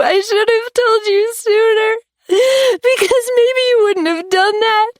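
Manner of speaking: very high tearful apology; crying confession. A narrator's reading. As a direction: sob out the apology.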